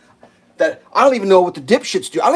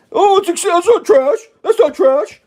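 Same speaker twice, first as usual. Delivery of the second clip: higher and louder mock outcry; mock loud protest; 13 semitones higher, 7 dB louder, slower than usual. Worker defending the boulder, in a loud mock outcry. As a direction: blurt it like a startled worker. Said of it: "laughs before mock quote".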